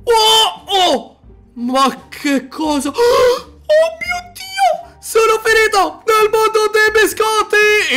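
high, loud astonished yelling; excited shouting. Acted. Player landing in the cookie world, shouting in high excitement. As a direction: shout out in wonder at the new world.

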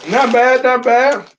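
crunchy snack in mouth; chewing chips while talking. Real, not acted. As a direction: mouth partly full of chips. Seen 0: Man holds chip piece near mouth, jaw working while speaking.

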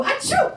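loud, high staged sneeze; fake sneeze. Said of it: "they go".

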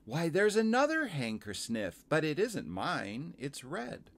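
put-on high, surprised child character; little girl. Girl spotting someone else's hankie, high voice.

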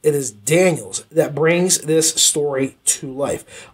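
slow, weighty stress on "Daniels"; deliberate emphasis. Shown as a demonstration. Reviewer announcing a key point, slow and deliberate. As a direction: say it slowly, landing on "Daniels". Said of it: "I'm gonna just say this like this".

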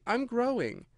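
high, small, apologetic; very meek. A narrator's reading. Alice explains herself to the Dormouse, meek and high. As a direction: confess it meekly. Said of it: "said Alice very meekly".

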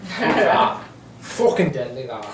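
a touch of Dublin accent; Irish accent. Shown as a demonstration. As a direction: swear like a Dubliner. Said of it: "for my Irish accent".

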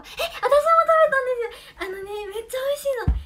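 eager, thrilled exclaiming; excited. Real, not acted. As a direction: gush about a treat you loved. Excited about the candy she ate.

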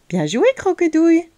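put-on high, triumphant boy's cheer; exclaiming. A narrator's reading. Léo has trapped Croquedouille, exclaiming. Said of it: "s'exclama Léo".

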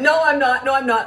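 imitating a kid protesting, high and loud; frantic denial. Son taking it back to mom, mock-frantic. Son hurriedly denies being bored.

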